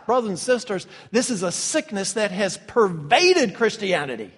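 loud, high, emphatic preaching; full-force preaching. Real, not acted. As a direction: proclaim it forcefully to the congregation.

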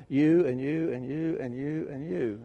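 repeated YOU, as if jabbing a finger at each person; pointing-finger address. Retold or imitated. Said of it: "like he's pointing a finger".

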